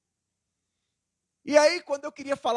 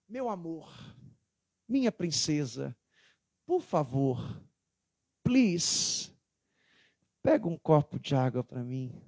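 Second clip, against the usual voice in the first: tender sweet request to the wife; 7 semitones lower, slower than usual. Retold or imitated. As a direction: coax her gently, lowering the voice. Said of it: "Agora chega pra mim".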